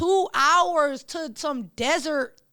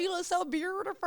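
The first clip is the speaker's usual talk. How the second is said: high-pitched Memphis-accent imitation; 7 semitones higher, 4 dB quieter than usual. Fan repeating the rapper's compliment to her, high Memphis accent. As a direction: echo the Memphis compliment, breaking into laughter. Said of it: "She said it in her Memphis accent".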